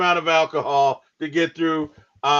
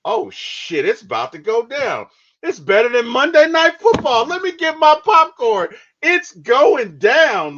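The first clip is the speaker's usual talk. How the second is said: hyped exclaim; 9 semitones higher, 6 dB louder than usual. Retold or imitated. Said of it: "and you're like".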